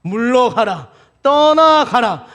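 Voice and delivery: loud, high cry, stretched out at the end; commanding shout. Acted. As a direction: shout the tempter away, building in force. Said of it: "우리가 외쳐야 할 말". (크고 높게 길게 외치는 명령)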